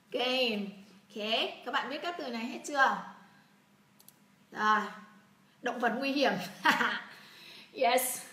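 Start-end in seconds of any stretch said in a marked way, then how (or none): 6.4-7.1 short amused laugh. Real, not acted. Teacher joking with students, laughing. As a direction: laugh at your own joke.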